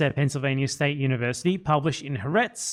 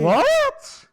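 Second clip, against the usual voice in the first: hammed-up squeak of disbelief, very high and loud; mock shock; 5 dB louder than usual. Mocking a stunned Zionist viewer, hammed-up shocked squeak. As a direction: feign total astonishment.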